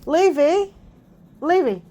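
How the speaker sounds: mother calling her son's name to wake him. Real, not acted. Calling sleeping son to wake him.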